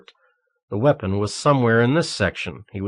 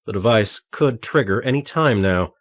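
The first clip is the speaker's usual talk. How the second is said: plain reading voice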